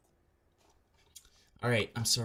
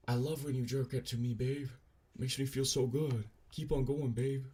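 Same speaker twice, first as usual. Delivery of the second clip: higher-pitched, lightly mocking girlfriend voice; lightly voiced girlfriend; 5 semitones higher than usual. Streamer reading girlfriend's texts, lightly mock-gushing. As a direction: read it as a hint of a gushing girlfriend.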